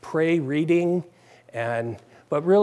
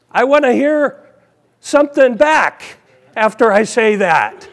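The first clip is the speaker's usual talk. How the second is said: emphatic laughing punchline; 5 semitones higher, 7 dB louder than usual. Real, not acted.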